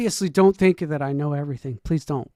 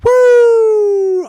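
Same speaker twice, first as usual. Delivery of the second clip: loud, high whoop; a real loud woo of glee; excited woo; 13 dB louder than usual. Real, not acted. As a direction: cheer like the home team scored. Cheers again at the driver name.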